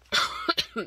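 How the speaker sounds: a sudden loud real cough. Real, not acted. Filming a haul, interrupted by a real cough. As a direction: cough hard mid-sentence. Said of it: "excusez-moi, je tousse un peu".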